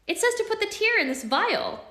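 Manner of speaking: thrilled by the discovery; Alex showing her brother the vial, high and excited; excited find. Acted. Alex reading.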